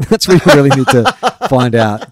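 loud and laughing through it. Real, not acted. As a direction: crack up mid-warning.

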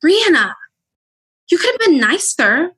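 high scolding voice saying "Brianna"; mock scolding. Guest imitates people scolding her bluntness in a high voice. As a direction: mimic people correcting her tone. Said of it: "And that's what".

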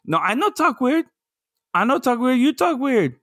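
high-pitched Brazilian voice protesting; mock Brazilian accent. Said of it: "Why are you talking like that?".

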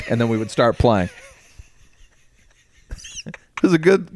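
ordinary talk, nothing marked

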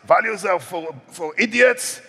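high, blunt Dutch accent impression. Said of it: "that's my Dutch accent".

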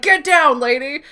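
high, loud hammy command; mock urgent warning. Sam orders Oksana down in the fake siege, mock urgent. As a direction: call it out like a bad action hero.

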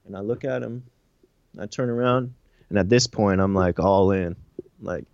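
ordinary delivery

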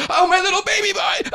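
high-pitched, hammed-up cooing over a friend; Brantley, doting. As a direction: gush in a squeaky high voice. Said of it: "squeezes you tight. And he goes".

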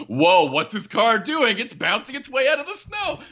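mock amazement. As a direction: play the neighbor gawking at a bouncing car. Neighbor amazed at bouncing car.

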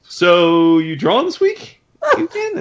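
high, drawn-out fake-casual question; mimicked sly fishing. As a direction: stretch it out and lift way up at the end. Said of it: "Dap was like".

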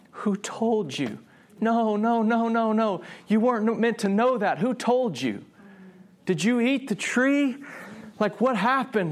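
high, pleading disbelief; grief-stricken. Acted. God learning that Adam knows he is naked, grieving. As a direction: plead in anguish, stretching the first question. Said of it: "there's grief, there's sadness".

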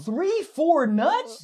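high, drawn-out disbelieving question; stunned, slow repeat; incredulous echo. Real, not acted. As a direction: stretch it out in disbelief, pitch climbing. Echoes a boast in disbelief.

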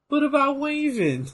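high wailed imitation; mock plaintive catchphrase. Repeating a favourite wrestling line, mock plaintive wail. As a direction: plead in a put-on high voice.

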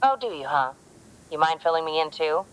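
usual speaking voice

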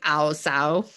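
clumsy Cockney vowels, "ow, so"; a badly done Cockney "ow, so"; attempted Cockney accent. Shown as a demonstration. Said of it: "vowels to show off that accent".

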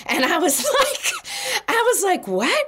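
high, laughing disbelief; laughing in surprise. Real, not acted. A stranger in the boarding line compliments her, and she laughs in surprise. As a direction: laugh it off, caught off guard.

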